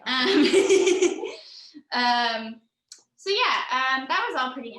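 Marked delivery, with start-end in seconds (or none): 0.3-1.9 high, loud burst of laughter; bright laugh. Real, not acted. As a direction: laugh it off, amused. Poet laughs at heckler.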